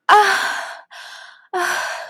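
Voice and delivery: two high-pitched moans; soft moan. Acted. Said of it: "I moaned softly".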